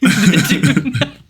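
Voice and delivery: amused slow repeat; laughing. Real, not acted. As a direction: chuckle through it slowly. Repeating the funny name.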